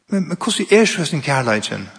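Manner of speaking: ordinary talk, nothing marked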